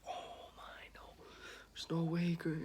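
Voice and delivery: shocked whisper. Retold or imitated. Classmates gasp at his remark.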